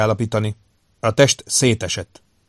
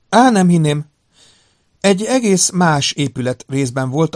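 higher, louder voice of a female doctor; woman doctor, doubtful; 6 semitones higher, 5 dB louder than usual. Acted. Doctor doubting the charts were reached, higher woman's voice.

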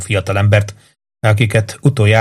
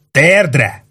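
a sharper, higher barked repeat of "Térdre"; yelled order; 5 semitones higher than usual. Acted. Cop screaming "Térdre" down at the fallen boy, harsh yell. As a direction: snap "Térdre" out as a harsher command. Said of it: "Üvöltötte".

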